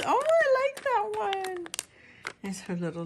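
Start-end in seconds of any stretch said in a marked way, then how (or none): 0.0-1.7 much higher, delighted; high gushing I like that one; excited squeal. Real, not acted. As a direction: squeal over a favourite piece. Delighted at the Best Buds mix.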